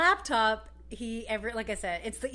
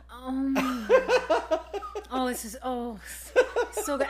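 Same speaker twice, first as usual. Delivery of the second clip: laughing imitation of blissful eating; mock food moaning; 3 semitones higher, 5 dB louder, much slower than usual. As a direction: moan over the food, half laughing. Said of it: "moaning and groaning so much".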